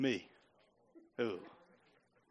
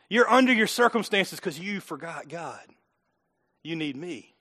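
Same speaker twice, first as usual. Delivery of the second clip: usual speaking voice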